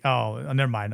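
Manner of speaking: ordinary talk, nothing marked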